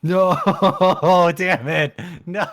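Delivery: loud, high laughing protest; laughing dismay. Real, not acted. Player groans at a bad roll, laughing loudly. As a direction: protest helplessly through real laughter.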